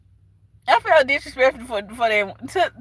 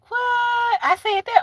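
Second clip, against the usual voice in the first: high, scandalized disbelief; mock shock; 11 semitones higher, slower than usual. Imagining herself watching her video back, mock shocked. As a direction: act shocked at your own words, pitched high.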